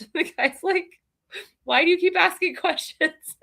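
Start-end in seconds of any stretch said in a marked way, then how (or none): 1.6-3.4 laughing while quoting. Retold or imitated. Mock scammer, laughing.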